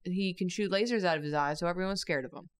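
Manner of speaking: ordinary talk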